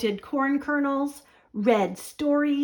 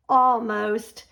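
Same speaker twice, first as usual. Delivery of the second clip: plain reading voice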